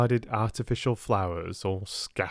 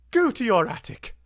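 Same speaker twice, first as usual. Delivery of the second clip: put-on higher, sharp order to a servant girl; commanding; 12 semitones higher, 4 dB louder than usual. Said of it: "she commanded".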